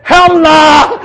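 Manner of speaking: high, loud, hammed-up Singlish yell; mock cry for help. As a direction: shout as a comically drowning Singaporean. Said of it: "he was sinking in water".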